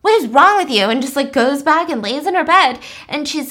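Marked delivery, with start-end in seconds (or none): 0.0-0.8 loud, higher incredulous outburst; disgusted outburst. Retold or imitated. Sawyer recoiling at strange roommate, loud. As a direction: recoil at her. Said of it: "looking at her like".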